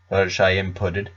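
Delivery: usual speaking voice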